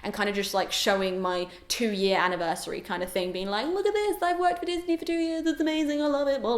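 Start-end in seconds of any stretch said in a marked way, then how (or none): 3.7-6.6 gushing booklet voice. Retold or imitated. Quoting her booklet gushing.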